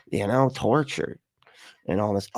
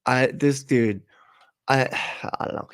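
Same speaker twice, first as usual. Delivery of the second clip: ordinary talk, nothing marked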